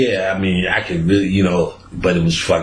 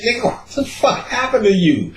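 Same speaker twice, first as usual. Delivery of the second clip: high, laughing shocked question; laughing disbelief; 13 semitones higher than usual. Retold or imitated. Friend asking the sick Doc, high laughing disbelief. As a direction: laugh while asking what happened.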